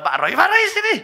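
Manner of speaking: high, loud call across the church. Retold or imitated. Pastor calling a colleague over with a high loud call. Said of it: "Saya panggil lo".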